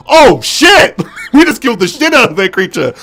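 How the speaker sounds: high, loud burst of astonishment; hammed-up "what have we done" exclamation; mock shocked outburst. Girl who just killed a creature, mock shocked outburst. Acted reaction to killing the creature.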